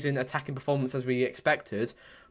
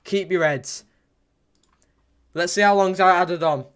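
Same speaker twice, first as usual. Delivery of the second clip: ordinary talk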